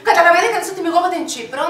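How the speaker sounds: ordinary talk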